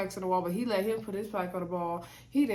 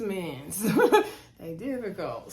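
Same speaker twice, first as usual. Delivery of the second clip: slow, laughing mock exasperation; laughing mock-weary wow; amused sigh; slower than usual. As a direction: sigh over these men through a laugh. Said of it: "and I'm like".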